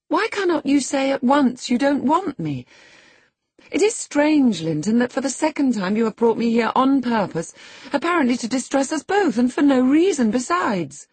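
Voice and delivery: high, loud, insistent accusation; indignant reproach. A narrator's reading. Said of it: "cried Catherine".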